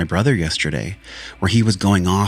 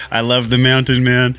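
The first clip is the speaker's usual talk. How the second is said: higher and louder, a fond mock of her voice; playful imitation of his lady; 5 semitones higher, 4 dB louder than usual. Man mimicking his lady's praise, higher and louder. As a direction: echo his partner's delight, hammed up a little. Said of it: "She's like".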